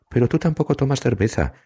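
Evelyn's voice. Acted. Evelyn's voice in quarrel.

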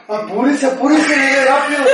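high, loud plea to hurry; urgent shout. Retold or imitated. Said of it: "Y yo le decía a mi mamá". (grito urgente pidiéndole a mamá que acelere)

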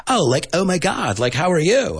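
high-pitched exaggerated American gushing; over-the-top American "oh my God" accent; mock American accent. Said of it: "we always imitate each other".

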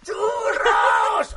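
loud, high call for churros; royal bellow. Pretend. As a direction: yell across the palace. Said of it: "Se empieza a oír". (reina gritando que quiere churros)

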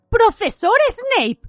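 high, loud, drawn-out shriek; scandalised nurse shrieking a name. A narrator's reading. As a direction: cry out shrilly, stretching the words. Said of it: "chilló la señora Pomfrey".

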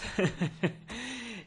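high-pitched laugh. Real, not acted. Speaker laughing after saying decades, high laugh.

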